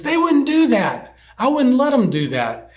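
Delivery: put-on high-pitched indignant boy shouting out; retold shout. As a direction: imitate a kid's upset outburst. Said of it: "he kind of shouted out".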